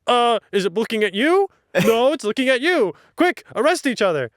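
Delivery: hammed-up bureaucrats passing blame; panicky ministers. Ministers panicking before the golem, flustered. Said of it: "ministers are there like".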